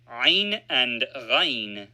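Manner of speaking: usual speaking voice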